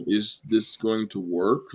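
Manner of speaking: slow, drawn-out doubting question; mock worried wondering. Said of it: "really question".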